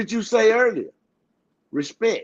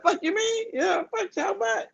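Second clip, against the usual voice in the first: much higher, softer comic snap; mock squeaky cussing; 13 semitones higher, 5 dB quieter than usual. Man contrasting polite and cussing versions, high mock snap. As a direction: squeak the cuss out, hamming it up. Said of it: "I'm like".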